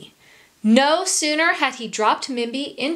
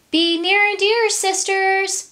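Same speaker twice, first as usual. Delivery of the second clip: calling out; 10 semitones higher, 4 dB louder than usual. A narrator's reading. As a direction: call out high and sweetly stretched. Calling to her sisters, high singsong.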